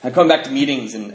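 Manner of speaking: normal talking voice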